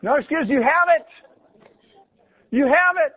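cheering the congregation on at the close; rousing exhortation. Real, not acted. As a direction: fire up the congregation.

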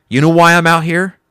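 loud, high retold police questioning; mock interrogation; accusing cop. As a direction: imitate an officer pressing a suspect.

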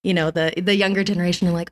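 usual speaking voice

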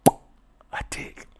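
sheepish whispered answer. Retold or imitated. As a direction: breathe it out, ashamed. Embarrassed answer in retold dialogue.

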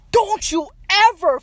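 high, loud mock-furious yell; angry confrontation. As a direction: yell it like you caught him cheating. Acting out confronting cheating boyfriend.

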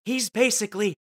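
loud, gushing mock fans, slowing on one of us; hammed-up hometown adoration; gushing Clevelanders. Cleveland fans gushing over Tom Hanks, loud and adoring. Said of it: "We go".

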